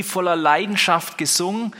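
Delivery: ordinary talk, nothing marked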